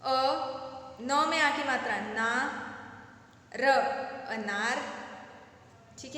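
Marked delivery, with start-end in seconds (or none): 0.0-4.8 अनार built as अ, ना, र; syllable by syllable. Shown as a demonstration. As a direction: sound out अनार slowly in three parts. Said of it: "अगला है अनार".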